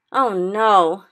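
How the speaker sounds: loud dismayed cry. A narrator's reading. Sophie cries out in dismay at the giant, loud. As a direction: protest in dismay. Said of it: "Sophie cried".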